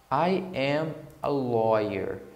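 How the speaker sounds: slow, clear model sentence for learners; model line to repeat. Shown as a demonstration. Teacher gives students a slow line to repeat after her. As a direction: say it slowly so students can repeat. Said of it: "você pode repetir depois de mim".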